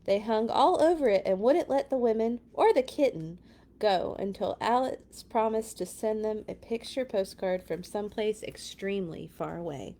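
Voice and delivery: usual speaking voice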